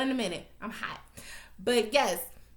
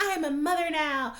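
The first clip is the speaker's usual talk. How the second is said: mocking; 5 semitones higher than usual. Mimicking a mother using motherhood as an excuse, mockingly. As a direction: mimic someone proudly playing the mother.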